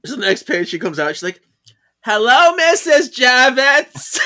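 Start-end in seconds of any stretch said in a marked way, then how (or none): 2.0-4.2 higher, louder, slow drawn-out greeting; nosy neighbor greeting over the fence, drawn out. Acted. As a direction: sing out a hello like the busybody next door. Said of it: "That's how she sounded in my head".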